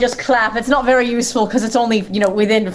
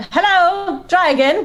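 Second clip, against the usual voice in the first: high, courteous call-out; sweet high hail without real force; polite yell; 10 semitones higher, much slower than usual. Shown as a demonstration. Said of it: "not if we yell politely".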